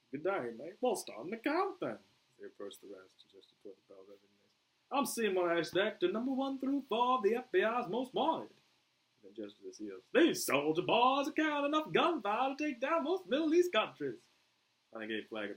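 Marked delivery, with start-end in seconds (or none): 0.1-2.0 high-pitched Australian reply; Australian accent. Acted. Boomer retorts to Flag, Australian accent. 4.9-8.5 put-on high, loud Australian accent. Boomer sizes up the inmates, Australian accent. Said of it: "this was entirely him". 10.1-14.2 put-on very high, loud Australian accent; exaggerated Australian, pitched way up. Boomer eyes the armed soldiers, Australian accent. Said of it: "this was entirely him".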